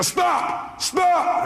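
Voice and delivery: sharp high shout to cut someone off; re-enacted yelled command; yelling. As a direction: yell to halt a rambling caller. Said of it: "I started yelling on the phone".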